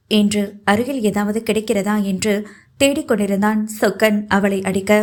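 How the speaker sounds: ordinary delivery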